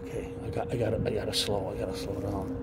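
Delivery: low murmur under his breath, nearly silent; barely audible muttering while hiding; hushed self-talk. Real, not acted. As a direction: keep it down so nobody hears you. Hiding near train, talking low.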